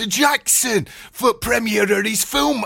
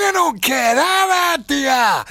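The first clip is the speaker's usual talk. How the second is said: high, affronted protest; offended hard man digging in; indignant refusal; 6 semitones higher than usual. Acted. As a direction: refuse it outright, voice raised in pitch. Refusing the dwarf role.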